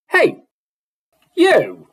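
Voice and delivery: put-on loud English accent yell from below; English accent shout. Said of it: "he shouted with an English accent".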